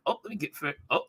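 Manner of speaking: ordinary talk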